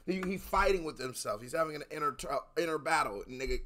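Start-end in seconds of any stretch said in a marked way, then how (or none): none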